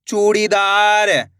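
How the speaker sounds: syllables joined into one slow word; blended word. Shown as a demonstration. Teacher joining the syllables for learners, slowly blended. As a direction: read it as one whole, stretched word. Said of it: "इनको आपस में लाकर पढ़ना है".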